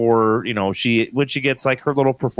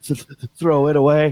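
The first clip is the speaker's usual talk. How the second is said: slow, gasping mock sobs, stuttering; higher-pitched hiccuping child's cry; imitated crying; 5 semitones higher than usual. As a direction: choke out words like a sobbing child. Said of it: "why you're crying".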